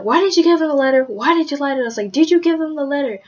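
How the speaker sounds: mock the dad's furious questioning; angry dad. A retold dad grilling his daughter about the letter, angry. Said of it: "he got really mad".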